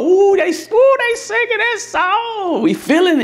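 high, excited imitation of a hyped-up congregation; mock hyped worship. Preacher imitating his congregation caught up in the music, high and excited. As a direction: squeal it like a church caught up in the song.

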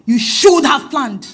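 ordinary talk

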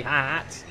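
'hearts' demonstrated with a long open vowel, no R; Australian pronunciation. Shown as a demonstration. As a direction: say 'hearts' the Aussie way, R dropped. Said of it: "That's how you pronounce it in this country".

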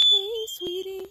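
high whistled chickadee call. Retold or imitated. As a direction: sing it like a little chickadee looking for friends. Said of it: "the chickadees going".

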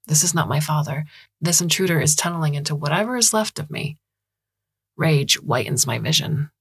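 plain reading voice

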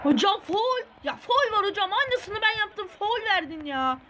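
high indignant complaint; protesting to the ref. Real, not acted. Complaining to the game referee about a foul: high indignant protest.

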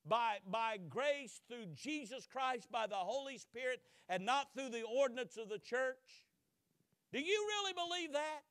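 loud, high incredulous grilling; interrogating. Retold or imitated. Court interrogating Luther, loud and incredulous. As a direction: press him hard with each question. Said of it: "this is the line of questioning".

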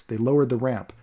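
ordinary delivery

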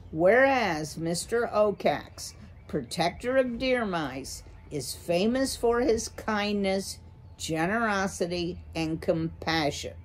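ordinary delivery

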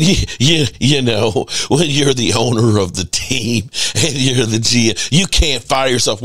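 low mocking take on Jerry's excuse; lightly voiced, dismissive owner; imitating Jerry. Cowboys fan mocking Jerry's excuse, low and scornful. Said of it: "from Jerry saying".

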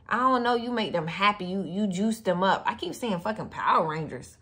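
ordinary talk

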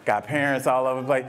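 usual speaking voice

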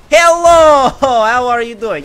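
high, loud, drawn-out hello with a laugh; gleeful greeting. Real, not acted. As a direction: holler a mock-friendly greeting at an opponent. Greets an enemy player gleefully.

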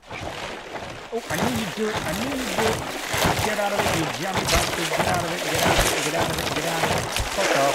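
loud, agitated shouting at an attacker; heated, repeated shouts; angry yelling. Real, not acted. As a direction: yell to drive the attacker off. Yelling at shark attacking the ship.

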